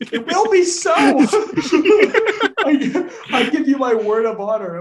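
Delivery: high, loud hammed-up insistence; indignant shout. Master contradicting his butler, theatrical indignant shout. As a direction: shout it high, then laugh through it. Acted retort to butler's refusal.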